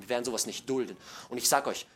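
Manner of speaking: normal talking voice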